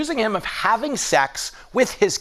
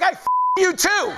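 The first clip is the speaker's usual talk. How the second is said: imagined snappy comeback; 11 semitones higher than usual. Retold or imitated. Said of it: "you wanna be like".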